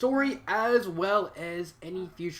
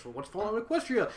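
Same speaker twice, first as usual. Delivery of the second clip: higher-pitched imitation of a newcomer; mock curious question; 5 semitones higher than usual. Reviewer playing a newcomer among fans, curious. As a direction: ask it like a puzzled outsider.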